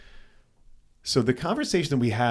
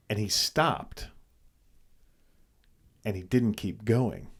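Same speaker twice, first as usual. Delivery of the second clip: ordinary talk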